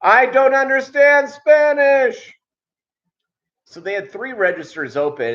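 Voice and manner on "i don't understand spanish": high, slow, loud impatient shout; mock yell. Cashier yelling at Spanish-speaking ladies, loud and slow. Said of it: "this guy raised his voice going".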